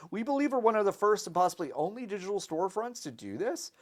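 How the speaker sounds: hyped YouTube host read; YouTuber voice. Acted. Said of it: "You're doing it in the YouTuber voice".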